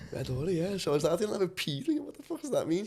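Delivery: high squeaky retelling of his own words; jokey high-pitched self-quote; mock high voice. Mock high retold reaction.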